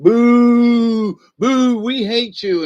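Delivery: loud, drawn-out mock booing; crowd booing. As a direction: imitate kids jeering, long high boos. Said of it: "they all went".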